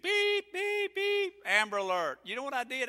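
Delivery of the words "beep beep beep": high, flat electronic beep; imitated TV beep. Mimicking the TV alert, high and flat. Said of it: "I heard the T V beeping".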